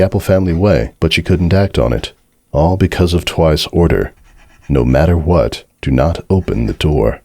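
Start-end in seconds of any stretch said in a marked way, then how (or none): none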